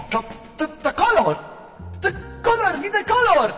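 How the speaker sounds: high, loud excited discovery; thrilled high exclaim; amazed cry. Acted. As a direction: shriek with wonder at seeing colour.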